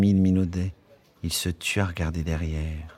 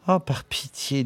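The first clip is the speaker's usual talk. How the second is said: sighing; 7 semitones higher than usual. Acted. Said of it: "soupira mademoiselle Douce".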